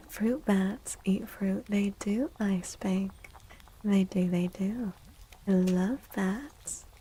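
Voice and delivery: ordinary talk, nothing marked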